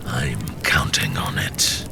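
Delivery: low, hushed, menacing lord; cold dark lord, low and half-whispered; scheming dark lord. Acted. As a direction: whisper your orders like a warlord plotting. Dark lord answers his servant.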